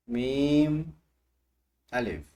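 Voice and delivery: मीम called out loud, अलिफ falling; loud drill call. Shown as a demonstration. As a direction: call out the pair. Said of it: "जोर से बोलें". (ज़ोर से मीम अलिफ)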